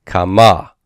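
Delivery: "kama" with a long, strong final MA; stress on final syllable. Shown as a demonstration. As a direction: lean on the last syllable of "kama". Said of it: "you'll notice here that the accent is different".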